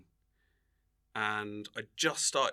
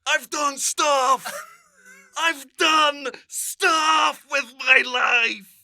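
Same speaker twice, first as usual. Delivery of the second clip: high, loud, drawn-out protest; mock anguished wail; 13 semitones higher, 9 dB louder, much slower than usual. Actor protesting a forgotten career, loud mock wail. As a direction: wail it out in hammed-up despair.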